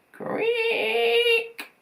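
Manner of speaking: high squeaky creak, voiced like a hinge; creaky door noise. A narrator's reading. Narrator voicing the creaking floor as a high squeaky creak. Creaking sound effect in story.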